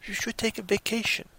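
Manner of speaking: a hint of a gentle doctor's advice; doctor quote. Doctor advising the stressed guy, lightly voiced and soft. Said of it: "and his doctor says".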